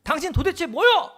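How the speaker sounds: angry man demands whether God even exists; yelling at God. Retold or imitated. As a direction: hurl the challenge at God, pointing a finger. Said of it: "하면서 막 삿대질하면서 따졌대요".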